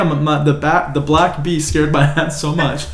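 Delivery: normal talking voice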